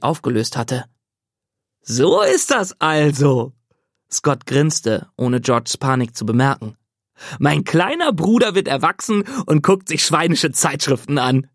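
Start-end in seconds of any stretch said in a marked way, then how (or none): 1.8-3.5 slow, drawn-out knowing tease; knowing brother. Acted. Scott thinks he caught her. 7.4-11.5 teasing brother. Acted.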